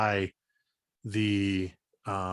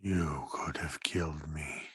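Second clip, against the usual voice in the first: whispering stone creature; 5 semitones lower, 6 dB quieter than usual. Acted. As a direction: hiss the accusation softly. Stone figure accuses player.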